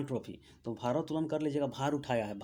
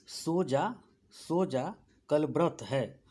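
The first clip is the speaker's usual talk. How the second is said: very slow, drawn out like a gentle hush; teacher chanting the mnemonic slowly to the class; slow lulling mnemonic; much slower than usual. Shown as a demonstration. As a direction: hum the trick out slowly like a lullaby line. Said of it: "स से super कर लीजिएगा".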